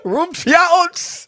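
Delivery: high gibberish alien language; fake Klingon. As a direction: spit out fake Klingon words. Made-up Klingon words.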